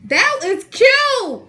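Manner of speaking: high, drawn-out sarcastic "that is cute"; pretending to sneer at the teacher, high and slow; mock sarcastic greeting. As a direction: greet the teacher with fake sweetness, stretched slow. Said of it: "I walk in the classroom like this".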